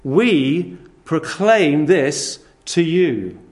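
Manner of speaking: very slow, every word weighted; slow emphatic reading. Shown as a demonstration. Preacher boiling the passage down to one sentence, very slow. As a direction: lay out each word deliberately. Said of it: "shortened to just this".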